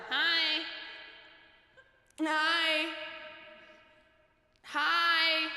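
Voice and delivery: put-on baby waving hi. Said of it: "The same thing happens every time".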